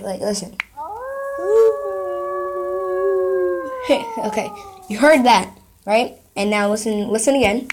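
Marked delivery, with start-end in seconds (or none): 0.6-4.1 long, high wolf-like wail; howling cry. Shown as a demonstration. Showing viewers how they cry like the wolf, howling cry. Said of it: "that's how I cry".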